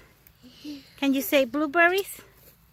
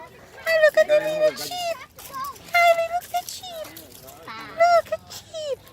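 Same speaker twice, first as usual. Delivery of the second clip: excited cooing; 10 semitones higher than usual. Real, not acted. Parent showing Kylie the little sheep, high and excited.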